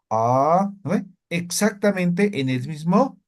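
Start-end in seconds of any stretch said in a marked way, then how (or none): none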